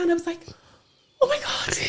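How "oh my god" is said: much higher, breathless crying whisper; whispered tearful joy. Retold or imitated. Mum overwhelmed her daughter spoke, crying whisper. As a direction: barely get it out through tears. Daughter says purple again.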